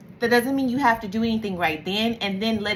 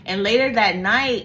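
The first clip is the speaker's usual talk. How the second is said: usual speaking voice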